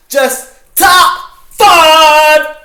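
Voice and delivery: drawn-out hammed-up host announcement; announcer shout. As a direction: stretch out the title like a host. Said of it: "after doing that amazing intro".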